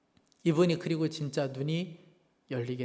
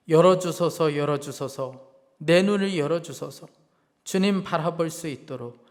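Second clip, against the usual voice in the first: a solemn recited poem, each 열어주소서 opening louder; poem recitation; 4 dB louder than usual. Acted. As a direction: recite it like a hymn of longing.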